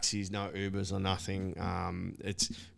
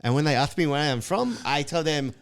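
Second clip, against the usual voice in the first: high, lisping Israeli friend voice; lisping impression; 6 semitones higher, 8 dB louder than usual. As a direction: do his high voice with a lisp. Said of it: "he speaks like this".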